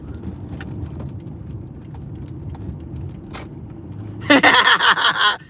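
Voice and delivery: airy unvoiced chuckle fading out; breathy laugh. Real, not acted. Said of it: "make yourself smile".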